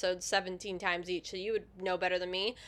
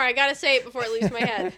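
fast, louder, laughing urgency; laughing rush; 6 dB louder, faster than usual. Real, not acted. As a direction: blurt it out through laughter.

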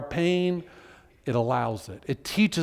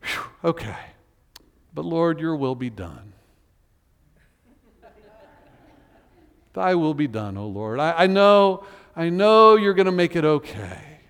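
relieved psalmist. Retold or imitated. Psalmist after venting, mock relieved prayer. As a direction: exhale and settle into trust. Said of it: "you would expect, I think, like".

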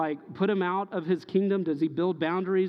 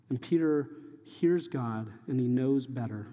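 normal talking voice

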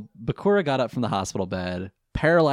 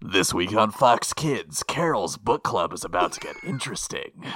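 mock TV promo voice; 3 semitones lower than usual. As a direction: sell it like a kids' TV ad.

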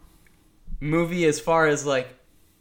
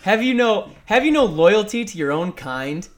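mock outrage; 5 semitones higher, 5 dB louder than usual.

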